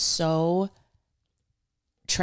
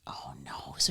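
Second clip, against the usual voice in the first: whispering; 3 semitones lower, 10 dB quieter than usual. Real, not acted. Whispered reply to gossip.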